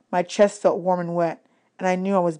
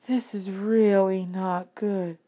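much softer, slow worried murmur; low murmur; 6 dB quieter, much slower than usual. A narrator's reading. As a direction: say it half to yourself, slowly. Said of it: "Annabeth murmured".

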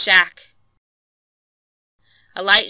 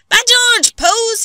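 much higher, loud amazed cry; astonished shout; 7 dB louder, slower than usual. A narrator's reading. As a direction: blurt it out in shock. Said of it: "he said".

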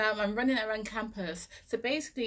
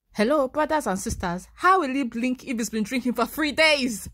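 louder, incredulous address; exasperated outburst; 4 semitones higher, 7 dB louder than usual. Retold or imitated. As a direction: throw the question at them, fed up. Exasperated at flatmates.

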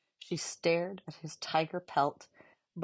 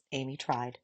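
plain reading voice

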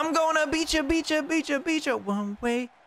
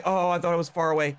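normal talking voice

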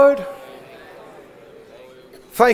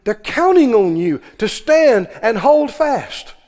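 normal talking voice

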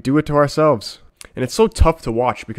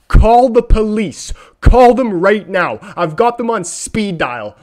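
mock outrage; 8 semitones higher, 7 dB louder, slower than usual. Real, not acted. Mock calling police, slowed.

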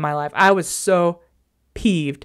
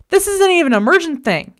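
raised, exasperated retort; 7 semitones higher than usual. Retold or imitated. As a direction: let him have it, higher pitch. Retelling argument with boyfriend.

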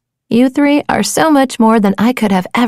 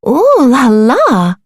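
mock-French ooh la la; slower than usual. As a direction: savour it like a fashion model. Trying on a fancy shell.

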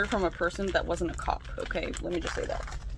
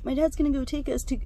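higher-pitched imitation of a boyfriend; Sammy/private; 7 semitones higher than usual. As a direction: lightly mimic him in a raised voice. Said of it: "Sammy's like".

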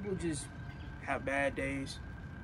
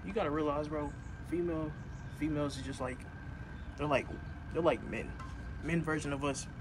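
ordinary talk

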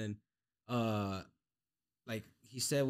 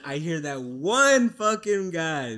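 ordinary talk

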